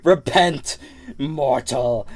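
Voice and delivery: a hint of a booming preacher condemning; mock preacher. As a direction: judge the dog like a mock prophet. Mock preacher judging the dog.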